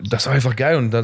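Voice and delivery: ordinary talk, nothing marked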